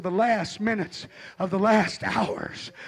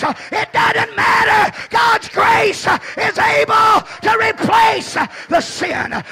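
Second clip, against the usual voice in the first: much higher, much louder, chanted "we gotta" lines; peak preaching shout; 5 semitones higher, 8 dB louder than usual. Acted. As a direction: drive each line home to the crowd. Preaching at full force.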